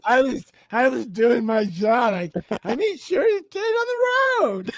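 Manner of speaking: high, slow, put-on voice of a dutiful helper; hammed-up self-justifying tone; mock innocent defense. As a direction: play the proud, blameless co-pilot.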